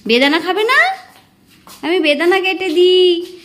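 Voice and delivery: ordinary talk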